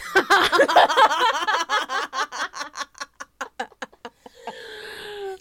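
high, loud giggling fit; can't stop giggling; giggly laughter. Real, not acted. Long burst of laughter.

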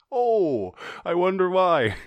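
high, loud mock wondering; sarcastic. Host reacting to a cost-price headline, loud sarcasm.

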